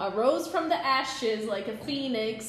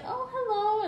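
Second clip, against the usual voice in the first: much higher, sing-song hello; sweet polite greeting; 12 semitones higher than usual. Retold or imitated. Greeting his mom, high and sweet. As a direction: chirp it politely. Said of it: "I was like".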